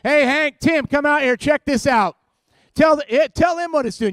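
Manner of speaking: loud, high shout to the back room; calling out. Retold or imitated. Mechanic summoning Hank and Tim to hear it, hollering. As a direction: holler for the guys to come over.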